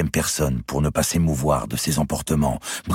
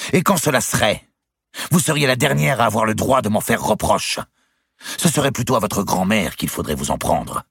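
higher, louder, bitter; angry retort; 9 semitones higher, 4 dB louder than usual. A narrator's reading. Louis lashes out at Clémence, angry. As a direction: throw the blame back. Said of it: "La colère chez Louis".

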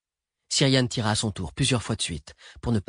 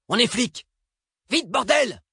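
very high, urgent squawk; high-pitched yelp; 3 dB louder, slower than usual. A narrator's reading. Driver yelps at fugitives to get in, high urgent squawk. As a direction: yelp at them to hurry. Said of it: "glapit Paul".

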